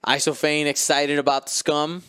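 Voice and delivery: ordinary delivery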